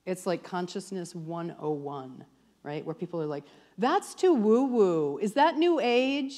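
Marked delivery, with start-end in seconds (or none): 3.8-6.4 mock skeptic. Skeptical students quoted.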